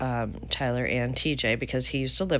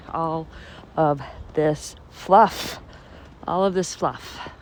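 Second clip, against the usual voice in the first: drawn-out wonder; 4 dB louder, much slower than usual. Real, not acted. Marveling at snow.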